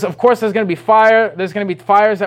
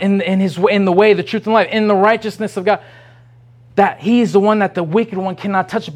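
normal talking voice

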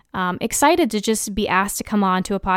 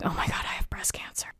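hushed, fast, frightened whisper; panicked whisper; 12 dB quieter, faster than usual. Retold or imitated. She whispers to herself after finding a lump, frightened whisper. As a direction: breathe it out like a secret fear.

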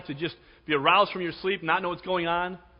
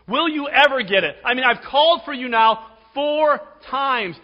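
exasperated scolding; 8 semitones higher, 9 dB louder than usual. Pretend. Hypothetical impatient God scolding Samuel.

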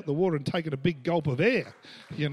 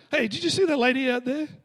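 high, raised questioning call; retold urgent call; 8 semitones higher, 4 dB louder than usual. Preacher retells asking a bystander about the lady, urgent call. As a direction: re-enact calling out to an onlooker. Calls to another onlooker on riverbank.

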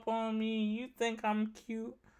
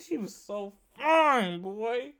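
normal talking voice

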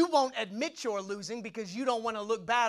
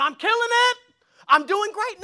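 bragging; 10 semitones higher, 8 dB louder than usual. Retold or imitated. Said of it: "Ain't nobody going".

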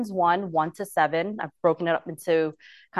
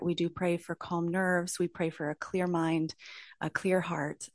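prayer; 3 dB quieter than usual. Acted. Leads an opening prayer.

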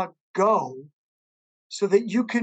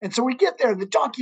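ordinary delivery

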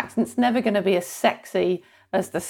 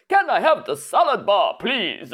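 hammed-up vampire accent; mock Transylvanian vampire voice; Dracula. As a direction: order politely as a cartoon vampire. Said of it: "Unless you're doing a Dracula accent".

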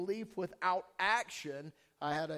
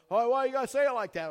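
loud, high, quick imitation of an objector; mock complaint; 8 semitones higher, 12 dB louder, faster than usual. Preacher imitating people who object to his message, mock complaining. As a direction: protest loudly, mimicking an offended listener.

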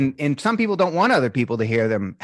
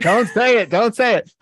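urgent self-warning, louder; 7 semitones higher, 5 dB louder than usual. Retold or imitated. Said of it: "I'll go".

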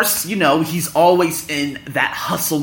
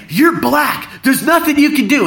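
high, loud imitation of a scolding woman; retold berating, pitched way up; mock berating; 12 semitones higher, 5 dB louder than usual. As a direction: hammer it at him like a harangue. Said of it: "she was berating me".

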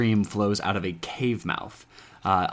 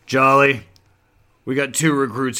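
loud, higher call across the hall; hailing a superior; 5 semitones higher, 9 dB louder than usual. Acted. Man pushing open the doors hails his boss, loud call. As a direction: call the name out big and high. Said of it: "and he goes".

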